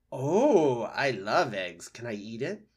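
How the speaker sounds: greedy fox. Acted. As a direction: savour the thought of eating the egg. Hungry fox voiced.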